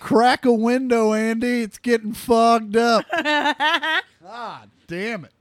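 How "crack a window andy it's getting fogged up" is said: higher, louder mock yell at the coach; mock call-out. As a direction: call out teasingly across the field. Mock calling out to Andy.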